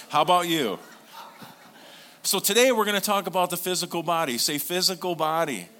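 loud, high preacher's call to the room; rally them into saying it back; full-force preaching. Acted. Pastor to congregation, loud full-force preaching.

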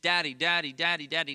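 tugging at dad for attention, mildly voiced; light imitation of a pleading young son; child calling daddy. Child calling father repeatedly.

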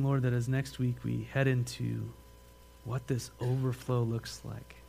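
calm, steady prayer voice; a pastor's gentle closing prayer. Acted. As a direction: pray quietly to the Lord.

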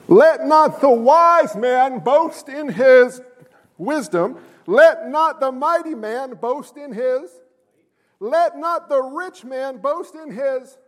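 slow, emphatic Bible reading; measured, weighty proclamation; scripture reading. Acted. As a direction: declaim the verse deliberately from the pulpit. Reads scripture aloud.

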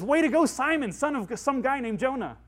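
high, loud hearty congratulation; Jesus beaming with loud praise; cheering Jesus. Retold or imitated. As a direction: cheer Peter on like a proud coach. Jesus voiced praising Peter.

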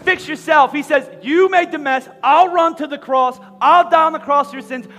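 loud, high-pitched preaching build; full-force preaching. Acted. Pastor to his congregation, loud rousing preaching. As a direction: drive the gospel point home to the crowd.

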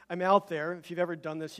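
ordinary delivery

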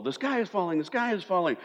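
high, mock-panicked cry of doom; panicky Chicken Little. Pastor mocking worriers as Chicken Little, high panicky wail. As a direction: squeal it like a frightened cartoon chicken. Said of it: "they're Chicken Little".

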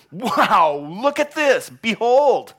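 loud, high, wide-eyed wonder; hammed-up awe, very loud; amazed exclamation. Shown as a demonstration. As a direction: cry out in astonishment. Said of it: "Behold is a Bible word for wow".

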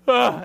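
high, loud imitated scream; mock scream of alarm; startled yelp. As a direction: yelp like a kid about to bolt. Imitates startled son fleeing.

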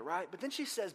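normal talking voice

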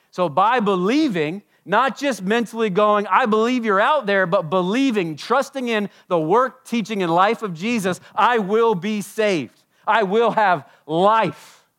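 loud, high preaching, slowing at the end; preaching at full force. Acted. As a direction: drive the point home to the congregation.

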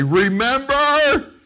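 rising, drawn-out loud "Remember?"; a big retold shout, climbing upward; excited calling out. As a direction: call REMEMBER high and loud, stretched out. Retold disciple calls out a reminder.

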